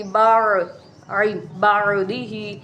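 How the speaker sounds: بعدہ drawn out slowly with the ain squeezed in the throat; throaty ain. Shown as a demonstration. Teacher modelling a throat letter, slow clear ain in بعدہ. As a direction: hold the throat sound ع in بعدہ. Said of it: "اس لیے عین کی آواز آئے گی".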